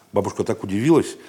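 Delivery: usual speaking voice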